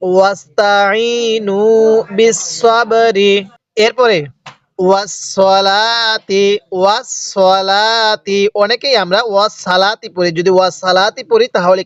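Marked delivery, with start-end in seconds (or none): 0.0-3.4 ওয়াস্তাইনু বিসসাবরি chanted slowly in a recitation voice. Shown as a demonstration. Teacher modelling the verse for students, slow chanted recitation. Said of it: "কিভাবে পড়তে হবে?". 4.8-8.5 ওয়াসসালাতি drawn out slowly and articulated clearly; careful recitation. Shown as a demonstration. Teacher teaching the word, slow careful recitation. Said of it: "কিভাবে পড়তে হবে?".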